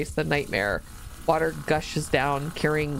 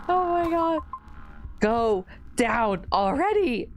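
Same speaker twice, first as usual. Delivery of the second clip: exasperated; 8 semitones higher, slower than usual. Real, not acted. Urging the sink to drain.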